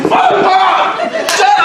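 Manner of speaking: hammed-up shout of fuck off; mock angry snap. Son snaps at his mom to shut up, mock angry yell. Snapping back at mom calling.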